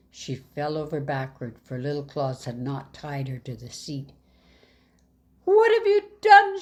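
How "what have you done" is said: high, loud alarmed shout; a loud, high-pitched cry of alarm. Acted. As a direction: bellow it in shock at the door. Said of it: "shouted Little Claus".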